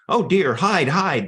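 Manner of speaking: narrated animals yelling in alarm; frantic yell. A narrator's reading. Frightened animals running in circles from fire, frantic yell. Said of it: "they yelled, running in frantic circles".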